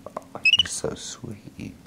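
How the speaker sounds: slow, airy, melting coo; adoring coo. Real, not acted. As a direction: melt over a sweet little pet. Cooing over monkey's kiss.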